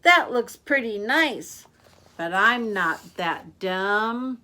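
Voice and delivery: loud, slow, drawn-out refusal; tempted but savvy. Acted. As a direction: admire it, then refuse knowingly. Girl admires but refuses the bait.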